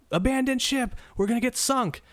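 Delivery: very high mock-panicked alarm call; freaking out. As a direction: play a man frantically warning an empty ship. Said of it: "He freaks out, he's running all throughout the ship".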